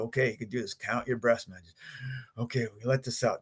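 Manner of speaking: softly talking himself down; calming himself after the fight in a quiet voice; quiet self talk. Retold or imitated. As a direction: murmur to yourself to calm down. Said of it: "I'm just go".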